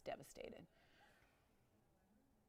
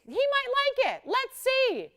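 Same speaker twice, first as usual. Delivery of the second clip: sing-song, much higher and louder; high playful voice; 9 dB louder, slower than usual. Pretend. Host playfully voicing Hunky Santa's reaction, high sing-song. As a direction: pipe it up high like teasing a pet. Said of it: "Let's see (repeated plain)".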